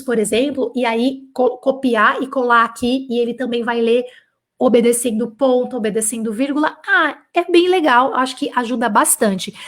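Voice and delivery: ordinary talk, nothing marked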